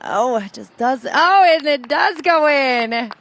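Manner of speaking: rising, loud excited putt call; golf commentator. Real, not acted. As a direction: call the putt dropping in, thrilled. Calling a made putt live.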